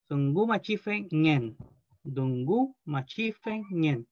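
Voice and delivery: dungu machife ñen spoken slowly, each part apart; slow model pronunciation. Shown as a demonstration. Teacher models dungu machife ñen slowly for the class. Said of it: "el acento que se le pone".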